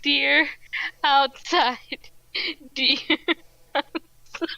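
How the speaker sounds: pointing it out through laughter; halting words, broken up by laughs; laughing. Acted. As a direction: barely get each word out for laughing. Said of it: "while I'm laughing".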